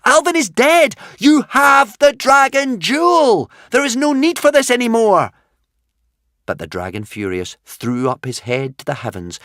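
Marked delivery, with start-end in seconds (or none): 0.0-5.3 high, loud pleading shout; helpless yell. A narrator's reading. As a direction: yell up at the dragon to stop. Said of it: "yelling helplessly up".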